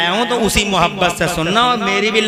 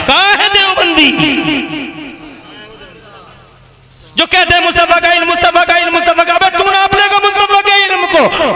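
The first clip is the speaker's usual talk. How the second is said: loud, high-pitched shouted preaching; full-force preaching; 10 semitones higher, 8 dB louder than usual. Real, not acted. Preacher challenging opponents about the Prophet's knowledge, full-force preaching. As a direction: thunder at the crowd in a high, strained shout.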